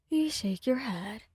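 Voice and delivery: usual speaking voice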